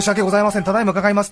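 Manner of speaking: high, polite waiter voice; bright apologetic server tone; customer service voice. Retold or imitated. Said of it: "なんて言われたりなんかして".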